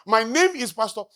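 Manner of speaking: mock pompous introduction. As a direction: puff up, self-important mock. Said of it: "Have you seen people talk like that?".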